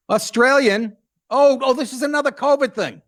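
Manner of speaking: suddenly high and eager; excited. Real, not acted. Host gleefully announcing the Australian clip, excited. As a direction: jump up with glee at the next story.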